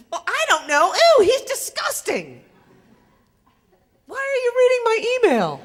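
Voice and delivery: put-on high-pitched, loud, half-laughing teen squeal; mock-disgusted giggly teen; teenage daughter. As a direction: squeal it like an embarrassed thirteen-year-old. Daughter voiced, teasing and indignant.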